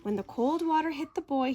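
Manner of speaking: usual speaking voice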